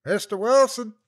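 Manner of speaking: high, loud call across the valley; distant shout. A narrator's reading. Searcher calling the lost hiker's name, shouting. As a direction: call out for a missing man from the ridge.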